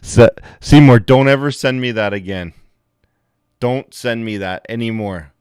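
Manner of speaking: high, loud cry of disgust; half-laughing outburst at Seymour; mock scolding yell. Real, not acted. Streamer yelling at viewer Seymour over a gross clip, loud mock disgust.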